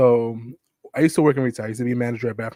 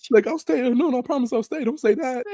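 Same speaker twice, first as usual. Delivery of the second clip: put-on high pleading old-lady voice, much higher; imitating sweet old lady; 11 semitones higher than usual. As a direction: beg sweetly in a lifted granny voice.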